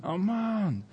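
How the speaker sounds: high, falling call without real volume; stretched-out searching call, held back; mock shouting. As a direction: imitate shouting from afar for a son.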